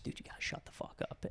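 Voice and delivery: fast whispered scolding; urgent hushing whisper. Retold or imitated. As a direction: hiss it quickly under your breath. Hushing friend while parents sleep.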